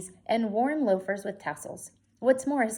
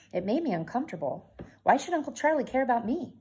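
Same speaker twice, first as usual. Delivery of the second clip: plain reading voice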